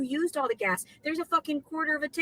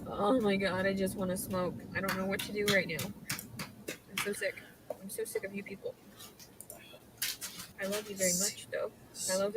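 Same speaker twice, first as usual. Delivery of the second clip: much lower and softer, worn out; drained, barely voiced; exhausted murmur; 8 semitones lower, 9 dB quieter than usual. Real, not acted. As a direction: spent after crying. Drained after crying.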